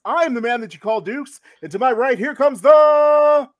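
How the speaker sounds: booming ring announcer; grand entrance announcement. Acted. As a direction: announce them like a title fight. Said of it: "That was the best intro ever".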